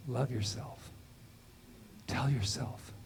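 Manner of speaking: soft whisper of a voice within; a gentle inner voice, whispered. Retold or imitated. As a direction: breathe it like a secret message. Inner voice he heard.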